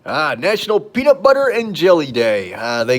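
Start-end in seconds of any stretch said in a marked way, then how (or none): none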